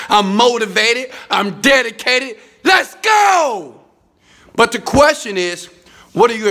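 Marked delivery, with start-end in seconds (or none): 2.6-3.8 loud, high rallying yell; hype shout. Acted. Motivator rallying his viewers with a loud, high shout. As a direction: fire up the crowd.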